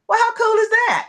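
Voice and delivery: high, loud delighted squeal. Retold or imitated. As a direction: squeal with joy at the news. Reaction to pregnancy news.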